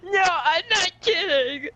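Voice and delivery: very high, slow, strained cry; panicked insistence. Acted. Friend insisting the zombies are real, panicked. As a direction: wail it out in mock panic. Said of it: "you say it like that".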